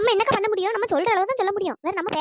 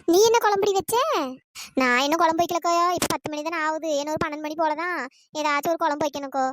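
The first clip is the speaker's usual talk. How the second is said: normal talking voice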